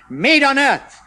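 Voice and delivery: loud, slow, high scolding; rebuking chemist. A narrator's reading. Chemist rebuking Uncle Roger, loud and slow. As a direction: tell him off sternly. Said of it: "the chemist rebuked him".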